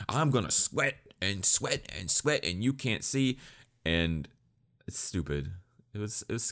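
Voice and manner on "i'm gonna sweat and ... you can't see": high, put-on voice of a deluded fighter; hammed-up boast in a silly high voice; mock the dude's big plan; mocking impression. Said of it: "this dude who thinks".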